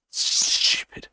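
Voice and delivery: softly whispered jibe; whispered aside. Real, not acted. Streamer after a joke falls flat, whispered aside. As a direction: whisper it under your breath.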